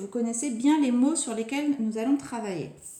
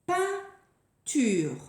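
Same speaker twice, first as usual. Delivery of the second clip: "Peinture" higher, in two clear beats; word announcement; 5 semitones higher than usual. Shown as a demonstration. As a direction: present "Peinture" with a short gap before ture. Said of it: "Nouvelle image".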